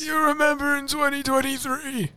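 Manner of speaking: high, frail-old-timer put-on voice; old and decrepit. Host pretending to reminisce decades later, old and decrepit. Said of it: "when we're all old and decrepit".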